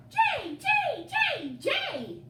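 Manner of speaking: very high, loud screech; sharp shrill bird cry; jay shriek. Acted.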